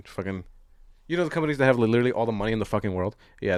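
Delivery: normal talking voice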